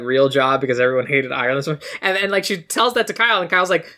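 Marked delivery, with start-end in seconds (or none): none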